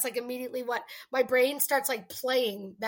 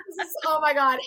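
delighted gasp; 3 semitones higher, 7 dB louder than usual. Retold or imitated. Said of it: "I'm like".

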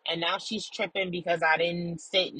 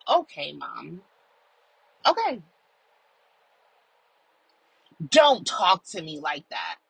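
retold snap back; 5 dB louder than usual. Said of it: "is what I did back".

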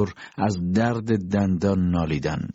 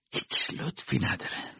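soft, unvoiced throwaway line; whispered dismissive aside; 5 semitones higher, 9 dB quieter than usual. A narrator's reading. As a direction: drop it almost silently.

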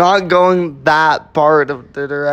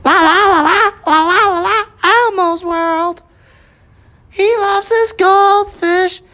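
very high, slow sing-song puppet; strained falsetto Elmo singing; 4 dB louder, slower than usual. Acted. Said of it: "do Elmo".

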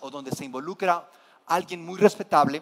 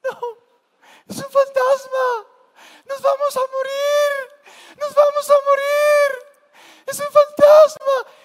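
much higher, louder, panicked mock shriek; hammed-up terrified scream; 7 dB louder than usual. Disciples screaming at the ghost on the water, hammed-up terrified shriek. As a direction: shriek like a grown man who has lost it. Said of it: "Gritaron de miedo".

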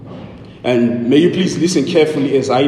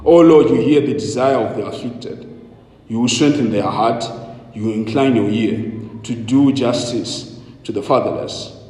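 hushed solemn scripture reading; 2 semitones lower than usual. Real, not acted. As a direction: read the psalm gently and solemnly. Scripture reading before sermon.

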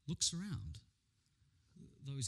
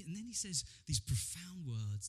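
ordinary talk

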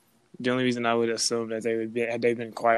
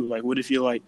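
ordinary talk, nothing marked

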